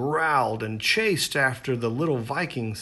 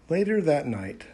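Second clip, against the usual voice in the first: plain reading voice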